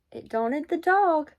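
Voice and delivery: ordinary delivery, nothing marked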